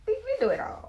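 high, loud exclaim; high excited burst; emphatic. Real, not acted. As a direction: throw it out big and high.

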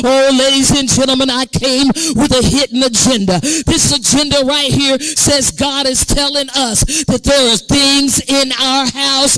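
loud, driving, relentless sermon delivery; full-force preaching. Real, not acted. Preacher challenging the church on what they manifest, full-force preaching. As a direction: preach it hard without letting up.

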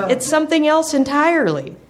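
high, insistent pup voice; puppy voice. Acted. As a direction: insist like a stubborn young pup.

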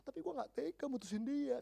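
imitated girlish whine; typical girl. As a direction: mimic a lovesick girl who can't bear it. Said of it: "Biasa kan, cewek-cewek gitu kan".

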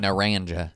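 "Naranja" said nuh-RAN-juh; American-style "Naranja" with a hard English j; Anglicized pronunciation. Shown as a demonstration. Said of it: "you know what they call it?".

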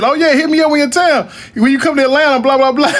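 high-pitched imitation of empty promises; mock friendly invitation. Transplant in Atlanta promising to meet up, mock friendly invitation. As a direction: mimic a fronting friend, breezy and high.

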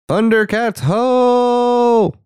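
high shouted cartoon cry; battle cry. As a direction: yell it like a cartoon hero. Cartoon battle cry ending incantation.

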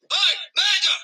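high loud alarm shout; high piercing shout of murder; raising the alarm. Acted. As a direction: cry out to wake the town.

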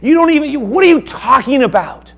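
high, loud, scornful outburst; mocking shout. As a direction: scoff at him at full volume. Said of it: "you can look at it".

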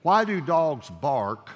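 ordinary talk, nothing marked

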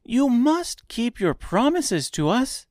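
put-on high-pitched insistent girl; girl exclaiming. A narrator's reading. Dorothy holding Oz to his promises, high exclamation. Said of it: "exclaimed Dorothy".

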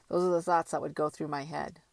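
ordinary delivery

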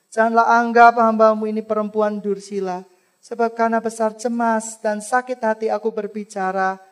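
Hana explaining herself to Eli, earnest; earnest defense. Acted. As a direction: defend yourself sincerely, heart broken. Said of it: "Hana berkata apa".

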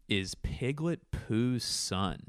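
slow, drawn-out "Is Piglet Pooh's son?" with a long "son"; slow dictation. Shown as a demonstration. Host repeating his podcast title while writing it down, slowly. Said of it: "let me write that down".

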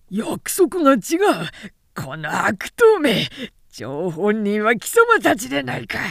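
loud outraged shouting; a condemned man bawling that he was betrayed; betrayed yell. Acted. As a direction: scream at the men who double-crossed him. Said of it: "とわめいた".

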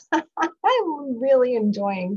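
laughing through the words, slow and delighted; giggly, pleased with herself. Real, not acted. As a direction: let a real laugh carry it. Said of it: "can you tell".

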